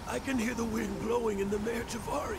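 awestruck. Acted. Hearing the wind, awed.

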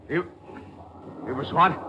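slow, stammering shock; halting, thrown off balance; stunned disbelief. Acted. Gangster hearing of the murder, stunned and stammering.

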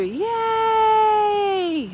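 loud squealing cheer; high shouted yay. Pretend. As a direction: shout it for joy. Excited affirmation chant.

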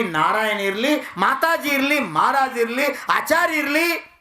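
impassioned preacher listing; rousing preaching. Real, not acted. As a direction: declaim it high, item after item. Preaches on mothers.

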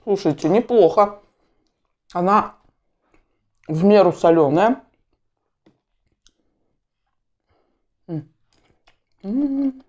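talking while eating; muffled by food; mouth full, chewing. Real, not acted. Seen 1: Chews after bread bites, then forkful of mashed potato at 2.3s.

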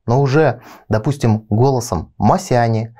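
ordinary talk, nothing marked

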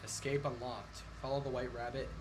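ominous announcer. Pretend. Reading end screen.

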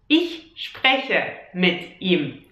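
Ich spreche mit ihm drawn out very slowly. Shown as a demonstration. Teacher repeating the example very slowly. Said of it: "But you can also say".

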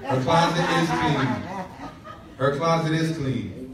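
playful laughing jab. Real, not acted. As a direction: tease it through a chuckle. Teasing about wife's clean closet.

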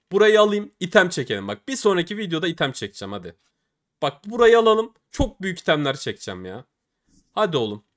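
loud, keyed-up urging; excited pleading. Real, not acted. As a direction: pump yourself up, begging for the drop. Begging the case for items.

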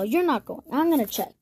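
ordinary delivery, nothing marked

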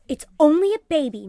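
rather sharp retort. A narrator's reading. Mother defends the snake to Missus Perkins, rather sharply. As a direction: answer back with some sharpness. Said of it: "replied rather sharply".

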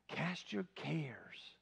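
slow, soft whispered reminder. Shown as a demonstration. Preacher repeating the song line to the congregation, whispered. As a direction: breathe the words out gently.

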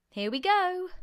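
high, loud call of warning; cried out. A narrator's reading. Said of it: "George cried out".

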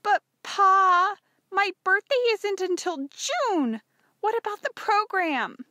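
put-on high, loud girlish outcry; dismayed protest. Dismayed daughter protests to father.

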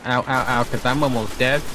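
ordinary talk

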